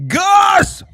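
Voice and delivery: sudden loud call across the room; high, loud yell for Gus. Real, not acted. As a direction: shout the name to get his attention.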